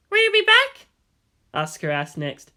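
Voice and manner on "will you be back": put-on much higher, loud, challenging girl's voice. Asuka challenging Shinji to promise he'll return, loud and sharp. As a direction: dare him to answer. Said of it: "tone was challenging".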